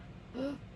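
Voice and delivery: soft, quick intake of breath; small gasp. A narrator's reading. Toucan hears the high expectations, small worried gasp. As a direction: gasp quietly in sudden worry. Reaction to the friends' big expectations.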